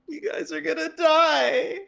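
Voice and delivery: high, loud wail of doom; dismayed cry. Real, not acted. Player warning the others they will die, loud high cry.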